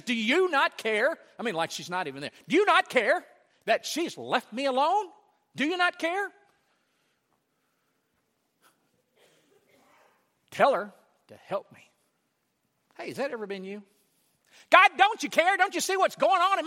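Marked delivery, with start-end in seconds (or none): 0.0-1.2 indignant. Retold or imitated. Martha confronting Jesus. 2.5-11.9 high, loud, aggrieved pleading; indignant complaint. Retold or imitated. Martha pressing Jesus to make her sister help.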